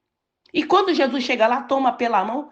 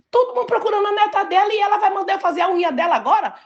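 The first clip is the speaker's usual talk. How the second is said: incredulous; 9 semitones higher, faster than usual. Retold or imitated. Imagined thoughts of a worried friend.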